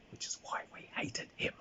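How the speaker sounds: hushed, breathy aside; whisper. Real, not acted. Whispering to listeners about the hated player. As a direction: whisper it like a confession.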